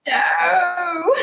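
sudden high, shocked cry; horrified no. Real, not acted. Friend reacts to her friend's many open tabs with a shocked high cry. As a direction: recoil in real horror at the news.

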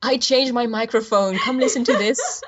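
selling the new mic with a hopeful lift; mock plea to listeners. To past listeners, mock begging them to listen again. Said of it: "link them to the new stuff and go".

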